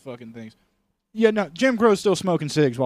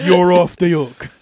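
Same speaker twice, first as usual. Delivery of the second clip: louder, slow drawn-out ruling; mock cop verdict; 4 semitones higher, 10 dB louder, slower than usual. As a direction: slowly let him off, hammed up. Acted cop dismissal.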